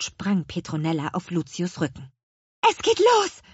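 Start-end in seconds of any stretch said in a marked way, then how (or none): 2.7-3.4 very high witch call. A narrator's reading. Little witch alerts everyone as Kümmerling arrives, calling out high. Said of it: "rief sie".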